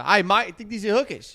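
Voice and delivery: Australian accent, hammed up; Australian man. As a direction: punch it like an Aussie bloke. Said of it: "heard an Australian man say".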